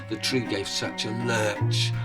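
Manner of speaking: plain reading voice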